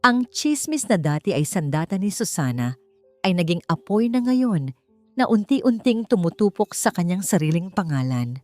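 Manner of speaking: plain reading voice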